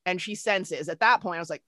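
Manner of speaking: usual speaking voice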